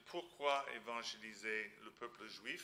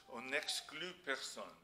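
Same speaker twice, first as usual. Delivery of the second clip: ordinary talk, nothing marked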